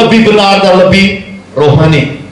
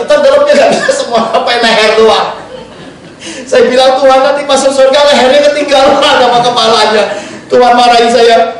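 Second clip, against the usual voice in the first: chuckling through the words, higher; laughing while telling; 7 semitones higher than usual. Real, not acted. Preacher laughing while telling the congregation a funny baptism story. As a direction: laugh as you tell the funny part.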